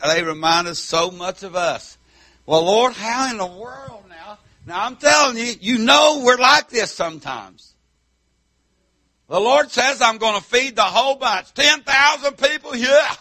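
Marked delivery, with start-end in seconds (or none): 2.5-13.1 loud, raised country-preacher voice; full-force preaching. Acted. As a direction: preach it hard to the congregation.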